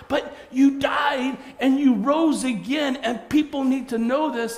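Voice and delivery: high, loud, slow insistent cry; excited protest. Acted. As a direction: burst out that everyone must know. Disciples protest loudly.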